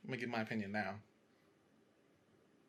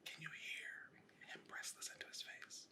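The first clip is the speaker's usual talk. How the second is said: breathy whisper with no voice; ASMR whisper; 15 dB quieter than usual. Acted. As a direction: whisper teasingly into the mic. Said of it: "we will be whispering into the mic".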